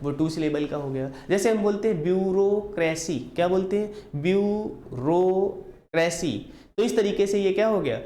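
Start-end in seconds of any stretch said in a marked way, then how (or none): 2.0-3.2 bureaucracy in three parts, view, रो, cracy; pauses between syllables. Shown as a demonstration. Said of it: "जितनी बार हम बीच में". 4.2-6.3 bureau, cracy very slowly as view… रो… cracy; long pauses between syllables. Shown as a demonstration. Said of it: "जितनी बार हम बीच में".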